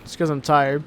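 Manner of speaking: ordinary delivery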